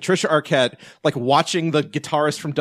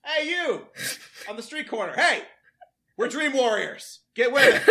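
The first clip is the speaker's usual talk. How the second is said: mock calling out; 9 semitones higher than usual. Pretends yelling at street passers-by.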